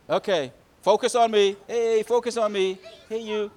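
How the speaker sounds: loud, high teacher call; calling for attention. Real, not acted. Calling distracted children back to attention.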